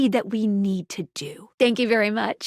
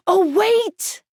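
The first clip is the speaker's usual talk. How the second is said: sudden realization; 7 semitones higher than usual. Acted.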